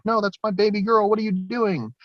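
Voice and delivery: a mother begging him to stop, voice up high; panicked plea. Retold or imitated. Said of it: "Medina was like".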